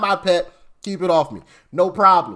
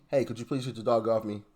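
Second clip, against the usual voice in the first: softer, polite request; asking nicely; 8 semitones lower, 8 dB quieter than usual. Retold or imitated. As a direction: make it a quiet, courteous ask. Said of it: "maybe she asked him nicely".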